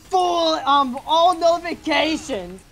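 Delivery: high, hyped YouTuber outro. Pretend. YouTuber voice bit again.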